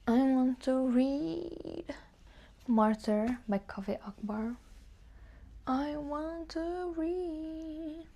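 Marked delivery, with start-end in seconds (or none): 0.1-1.8 drawn out. Real, not acted. Drawn out while thinking. 5.7-8.0 drawn out. Real, not acted. Drawn out again while thinking.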